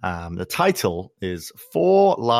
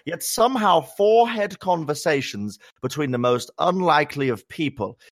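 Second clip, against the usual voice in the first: posh English accent, higher and slowed right down; upper-class English accent; 6 semitones higher than usual. Shown as a demonstration. Host reading a listener review aloud in an upper-class English accent. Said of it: "my accent sort of devolved into an upper English class accent".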